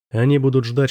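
ordinary delivery, nothing marked

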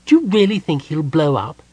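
wide-eyed and eager; curious Kender. A narrator's reading. Tass asks Jenna if Parlin will explode.

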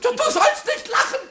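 laughing reproach. Acted. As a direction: scold him while you can't stop laughing.

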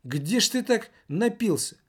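high-pitched scolding question; indignant woman. A narrator's reading. Woman asks a drunk where he drank, indignant. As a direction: ask it high, taking him to task. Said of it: "возмутилась одна из женщин".

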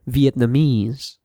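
"Vietnamese" slowly, last syllable stressed; "Vietnamese" as vietnaMESE, slow teacher's model; final-syllable stress. Shown as a demonstration. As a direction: stretch the final "mese" in "Vietnamese". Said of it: "falls on the final syllable".

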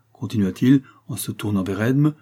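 plain reading voice

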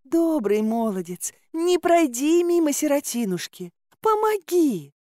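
high, drawn-out plaintive lament; plaintive wheedling. A narrator's reading. Swamp creature begs the hero for help, plaintive. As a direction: plead like a poor little orphan. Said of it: "плакалась хтонь болотная".

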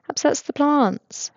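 deadpan brush-off; Mary's stock answer. A narrator's reading. Mary answering the radio question, lightly voiced and slow.

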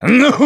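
high, loud maniacal laughter; wild cackle. A narrator's reading. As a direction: burst into savage guffaws trailing down. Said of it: "продолжал он с диким хохотом".

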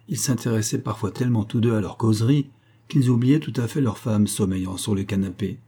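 ordinary delivery, nothing marked

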